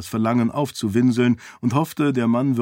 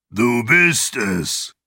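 slow, rough-voiced exclamation; drawn-out raspy cry of recognition; calling out; much slower than usual. A narrator's reading. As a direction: call it out as a huge hoarse man. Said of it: "rief der Mann".

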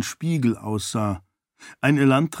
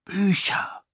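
breathy, thrilled hushed word; excited whisper; 9 semitones higher than usual. A narrator's reading. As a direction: whisper it in awe, higher. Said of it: "flüsterte Raoden aufgeregt".

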